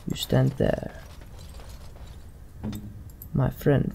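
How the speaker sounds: ordinary delivery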